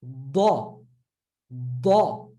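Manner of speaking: "ba" as a heavy D from a curved tongue; trap, then release "ba"; trapped sound. Shown as a demonstration. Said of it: "trapping the sound with a curved tongue".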